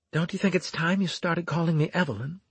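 high, light female character voice; voiced female lover. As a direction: lift the pitch for a coaxing woman. Lover speaks during lovemaking.

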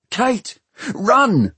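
high, alarmed yell of warning; sudden high-pitched cry; urgent shout. A narrator's reading. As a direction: shout it to make them flee. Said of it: "shouted Bevis".

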